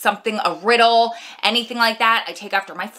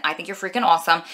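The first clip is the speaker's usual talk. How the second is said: ordinary talk, nothing marked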